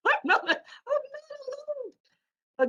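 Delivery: very high, drawn-out, laughing squeal; mock yell. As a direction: stretch it out in a high playful cry. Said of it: "Jacqueline tends to yell".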